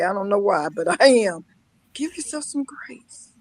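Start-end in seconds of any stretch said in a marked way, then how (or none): 1.9-3.3 much softer, gentle urging; soft advice. Real, not acted. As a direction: ease it out like a kind friend. Gentle advice repeated softly.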